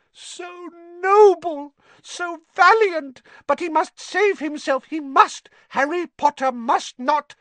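high elf voice, miserable ecstasy; squeaky, drawn-out adoration turning to pleading; Dobby moaning. A narrator's reading. Elf praises Harry then begs him to save himself, high-pitched. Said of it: "moaned Dobby in a kind of miserable ecstasy".

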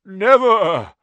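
put-on loud croaked old spider; croaking. A narrator's reading. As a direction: croak it out, ancient and firm. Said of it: "croaked the old spider".

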